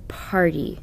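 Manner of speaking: Party said quickly as one whole word; quick plain Party; read quickly. Shown as a demonstration. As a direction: snap out Party fast. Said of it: "The review word should be read like this".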